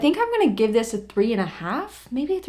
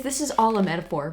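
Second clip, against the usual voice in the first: usual speaking voice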